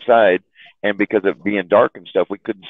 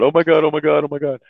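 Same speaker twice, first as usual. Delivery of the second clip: mock panic; 7 semitones higher, faster than usual. Girlfriend caught by the cop, mild mock panic. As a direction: imitate her mild panic. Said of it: "she's all freaking out".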